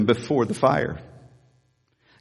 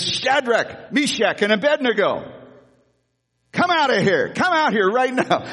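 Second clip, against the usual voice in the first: higher, urgent mock call; 8 semitones higher than usual. King calling the three men out of the furnace, high and urgent. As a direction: call them out of the fire.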